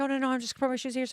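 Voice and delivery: fast flustered reply; quick mock-embarrassed brush-off; rushed protest. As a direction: rattle it off in a hurry. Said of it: "And I'm like".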